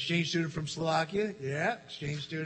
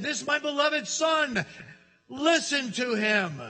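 louder, slow commanding pronouncement; voice of God; 8 semitones higher, 4 dB louder than usual. Acted. God speaking from heaven to disciples, slow and commanding. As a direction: proclaim it from heaven. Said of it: "he said".

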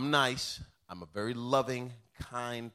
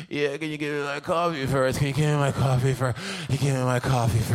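groggy wife demanding; 7 dB louder than usual. Retold or imitated. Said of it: "wife's reply".

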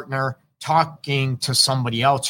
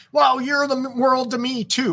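sweet mock reply; 11 semitones higher than usual. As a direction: play the partner melting at the compliment. Partner answers the loving words.

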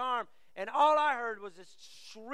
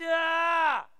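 loud, high, drawn-out "aah" of frustration; exasperated yell; 6 semitones higher, 9 dB louder than usual. Acted. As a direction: let out a parent's exasperated holler. Exasperated outburst at sons' backtalk.

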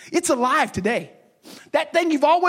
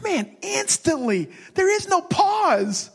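high, slow and deliberate; slow emphasis; 6 semitones higher, slower than usual. Real, not acted. Pastor stressing the word instantly to church, slow emphatic. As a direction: stretch each word for weight.

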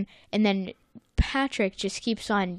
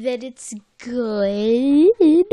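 loud, very slow, stretched-out praise; playfully drawn-out verdict; 3 semitones higher, 7 dB louder, much slower than usual. Real, not acted. Giving a verdict on the episode, playfully drawn out. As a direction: stretch "good" out long and swoop it upward.

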